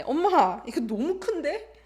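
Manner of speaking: high, loud call to mom, questioning end; surprised complaint. Acted. Acted complaint to mom about size.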